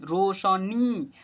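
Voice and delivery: Roshni stretched very slowly; slow sounding out. Shown as a demonstration. Teacher slowly reading Roshni aloud for children. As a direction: draw out Roshni, ro-sha-ni. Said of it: "र में उ की मात्रा शनी".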